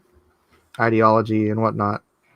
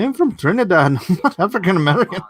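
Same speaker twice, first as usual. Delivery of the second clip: higher, laughing through the words; laughing quote; 7 semitones higher, faster than usual. Retold or imitated. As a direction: quote her while laughing. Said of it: "She's like".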